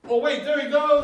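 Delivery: high, loud alarm; excited shout. Acted. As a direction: yell as it runs off. Chasing the loose mouse.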